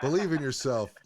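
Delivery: loud, high, gushing pep-talk parody; mock greeting card cheer. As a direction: belt it out like a cheesy greeting card. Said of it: "sounds like a bunch of fucking greeting cards".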